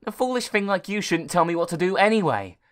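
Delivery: hammed-up arrogant kid. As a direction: sneer at the computer's advice. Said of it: "he replied arrogantly".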